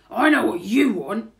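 loud, knowing burglar voice; hearty rising burglar line; burglar character. A narrator's reading. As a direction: announce it boldly to the baby. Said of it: "he says".

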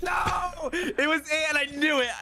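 high-pitched cry of defeat; dismayed wail. Real, not acted. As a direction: wail in protest at losing. Loses a round of game.

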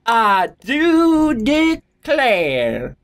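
slow, drawn-out Southern lady; I do declare, Southern charm; Southern belle accent. Pretend. Mock Southern belle line.

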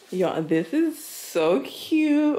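high, slow, tearful gush over a cute gift; squealing with joy; overjoyed gushing. Real, not acted. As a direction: melt with delight, almost crying.

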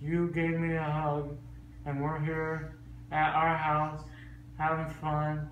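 after wisdom teeth. Real, not acted. Groggy tender talk to child.

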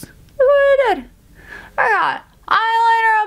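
high, loud cry of dismay; dismayed yelp. Real, not acted. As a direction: yelp at the mess she just made. Poked eye, smudged eyeliner.

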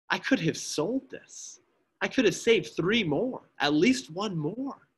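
high, slow, breaking lament; rising grief that collapses at the end; weeping anguish. Acted. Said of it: "he begins to weep".

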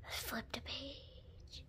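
soft unvoiced whisper. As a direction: breathe it out as a whisper. Whispered aside after the sigh.

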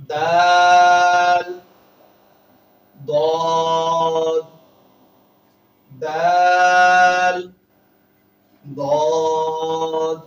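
দাল held very long and loud, দদ short and loud; drawn-out Arabic letter names. Shown as a demonstration. Teacher sounding out letters for pupils: long loud দাল, short দদ. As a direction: sound out দাল slowly, clip দদ, for the class. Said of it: "একটু লক্ষ্য করুন".

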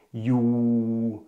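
"Ju" said on a low, level tone; teacher models "Ju" on the low tone; low tone Thai. Shown as a demonstration. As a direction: drop "Ju" onto the low tone. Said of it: "Ju im tiefen Ton".

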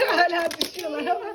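fast words through laughter; laughing. Real, not acted. Cars still falling, she laughs while telling viewers. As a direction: giggle the words out quickly.

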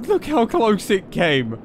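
ordinary talk

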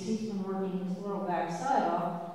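normal talking voice

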